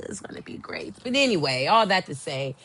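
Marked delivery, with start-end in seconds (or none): 0.0-0.9 very soft, voiceless gush; awed whisper. Real, not acted. Fan savoring next summer's concert, whispered excitement. Gushing repeat, dropped to a whisper.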